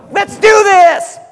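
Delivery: high, loud, slow pump-up yell; hammed-up shout to close the pitch; rallying shout. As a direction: fire up the recruit. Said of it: "and said".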